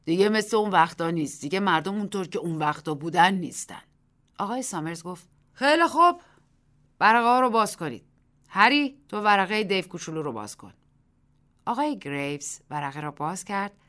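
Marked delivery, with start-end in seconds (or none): 0.0-3.8 a louder, lightly voiced old villager; old Warner declares it plainly; lightly voiced old man complaining of change; clear old man's voice. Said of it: "با صدای واضحی گفت". 5.5-10.7 much higher, louder official call; loud command. Acted. Mr Summers commands the crowd.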